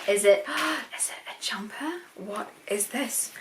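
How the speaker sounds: breathless whisper after a gasp; gasp, then whisper the guess. Real, not acted. Guessing what Ali sent, a gasped whisper.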